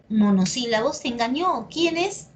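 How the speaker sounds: normal talking voice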